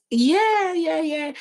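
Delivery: bursts out high and loud, then settles; a sudden high burst of yes; blurting it out with a high, loud first yeah; excited yeah. Real, not acted. Emotional admission to friend.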